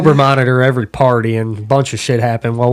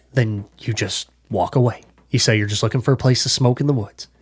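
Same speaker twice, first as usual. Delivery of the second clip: softer, confiding briefing as if a secret mission; hushed instructions; 2 semitones lower, 7 dB quieter than usual. Retold or imitated. Ranger buddy briefing him on the woods, hushed and confiding. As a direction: imitate the friend giving quiet orders. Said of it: "he would talk to you like you were the most important person".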